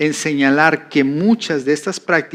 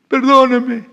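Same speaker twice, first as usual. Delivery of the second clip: much higher, louder, slow mock wailing plea; girl bursting into tears before the pastor, imitated; mock crying; 9 semitones higher, 5 dB louder, slower than usual. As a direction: wail for forgiveness like a caught girl. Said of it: "empezó a llorar".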